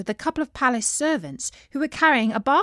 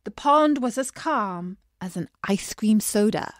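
ordinary delivery

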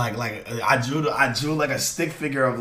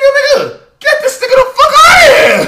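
much higher, louder laughing shout; retold shout; 13 dB louder, faster than usual. Retelling throwing a player out of his scene, laughing shout. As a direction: scream it while cracking up. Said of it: "I'm like".

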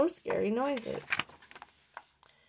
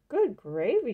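loud, higher exclamation; surprised cry; 4 semitones higher, 8 dB louder than usual. A narrator's reading. Old woman crying out at the empty churn. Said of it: "she cried".

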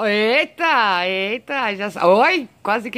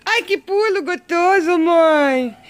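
much higher and louder, thrilled; excited squeal; 9 semitones higher, 6 dB louder, slower than usual. Real, not acted. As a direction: squeal with delight at the jump. Cheering cat's jump.

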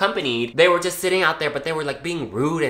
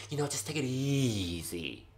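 quiet; 13 dB quieter than usual. Retold or imitated. Boss telling her to calm.

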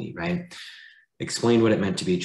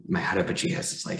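ordinary delivery

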